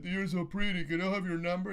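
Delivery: high-pitched, over-eager flattery; hammed-up fawning admirer; mock gushing. Acted gushing pickup line as bad example.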